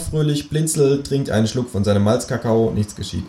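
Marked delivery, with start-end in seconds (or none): none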